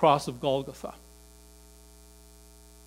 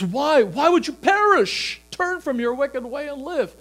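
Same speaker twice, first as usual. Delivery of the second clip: much higher, crying out, pleading; God pleading; 10 semitones higher, 5 dB louder than usual. Acted. God calling sinners to turn, high pleading cry. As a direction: plead like a father calling a lost child. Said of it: "he says".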